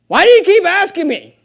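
high, loud exasperated protest; mock frustration. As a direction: act frustrated at being pestered. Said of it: "you and I would get frustrated".

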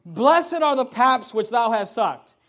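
slow, loud, declaimed Bible verse; scripture proclamation. Acted. Preacher reading the woman's words to Jesus, slow loud proclamation. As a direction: proclaim the verse slowly and strongly. Reads scripture aloud emphatically.

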